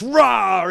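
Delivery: high, very loud bear roar; huge roaring burst; great roar. A narrator's reading. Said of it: "with a great roar".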